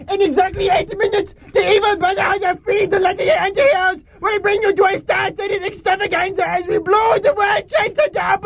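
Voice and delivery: high, fast megaphone villain announcement; bullhorn announcement. Acted. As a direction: blare it through a bullhorn.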